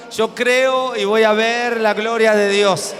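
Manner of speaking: loud, slow, drawn-out declaration; preaching at full force. Real, not acted. Pastor calling the church to declare faith, loud and slow. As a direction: lift the crowd into a shouted creed.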